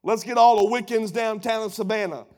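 usual speaking voice

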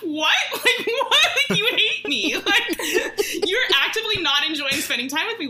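high, laughing disbelief, words breaking into giggles; incredulous laughing. Retold or imitated. Retelling to friends her baffled reply to the date, laughing high. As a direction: laugh through the "what?" as if it's absurd.

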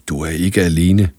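a remembered voice inside her head; the doctor's words echoing in her head, soft and low. Acted. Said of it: "Sætningen gjorde ekko i Lærkes hoved".

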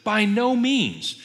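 slow, high-pitched emphatic denial; resounding, drawn-out refusal. Acted. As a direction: stretch each word and lift the pitch. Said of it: "this is emphatic".

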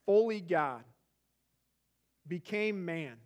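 hushed, unhurried prayer voice; closing prayer. Acted. Pastor leading the church in prayer, hushed and reverent.

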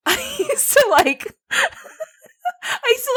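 high, squeaky laughing speech; real helpless giggles, words drawn out; embarrassed laughing. Real, not acted. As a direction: struggle to confess through laughter. Laughing while confessing.

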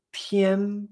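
"Tian" high and level; sustained, unwavering high "Tian"; first tone. Shown as a demonstration. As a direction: hold "Tian" on one high note. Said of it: "primer tono".

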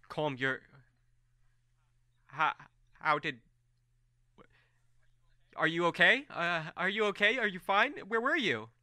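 rising, high, anxious questions; worried questions. Acted. Farron anxiously questioning the returned Colm, high and worried.

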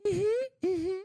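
high, hummed mm-hmm; mute mm-hmm. Retold or imitated. Voiceless Ariel humming at the prince, high and hummed. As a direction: nod along with a high hum. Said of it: "She's just looking at him like".

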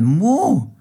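a desperate cow bellowing for help; loudest moo. Acted. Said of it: "Carola muhte so laut sie konnte". (verzweifeltes, lautes, hohes Muhen)

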